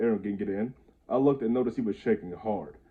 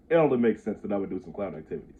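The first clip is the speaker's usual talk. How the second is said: ordinary delivery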